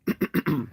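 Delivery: loud throat clear. Real, not acted. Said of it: "Sorry, losing my voice".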